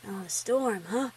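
a hint of a teasing big brother; older brother. Brother voiced, low, far timbre.

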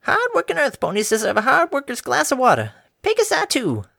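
put-on high, cheery elderly mare; a sweet old farm granny offering water. A narrator's reading. Granny offering water to the workers, high and cheery.

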